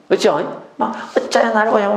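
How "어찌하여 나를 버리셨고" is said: raised-pitch acted complaint; lamenting. Preacher showing how psalmists cry to God, lamenting. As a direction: plead upward as if abandoned. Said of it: "막 다 탄식을 해요".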